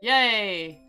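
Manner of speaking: usual speaking voice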